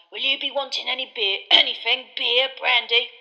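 put-on a toothless old barman's greeting; wizened landlord greets the Minister; old landlord Tom. A narrator's reading. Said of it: "said Tom".

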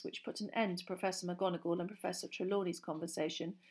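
usual speaking voice

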